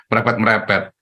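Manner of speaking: ordinary talk, nothing marked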